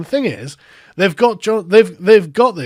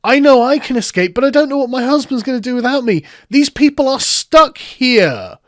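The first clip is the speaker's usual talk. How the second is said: high, raised-voice imitation of distress; mock screaming; 6 semitones higher, 4 dB louder than usual. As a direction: wail it mockingly, pitched well up. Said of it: "is just screaming".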